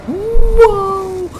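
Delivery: high, loud startled shout; a player jolted by a jump scare; startled whoa. Real, not acted. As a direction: yelp in sudden shock.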